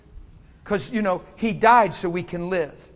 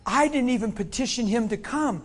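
ordinary talk

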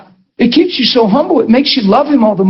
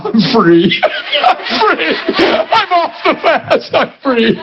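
high, slow cries of release through laughter; gleeful relief; 7 semitones higher, much slower than usual. Retold or imitated. Preacher reliving breaking his long fast, laughing gleeful relief. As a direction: act out laughing jubilation, pitch leaping up. Retold joy at ending the fast.

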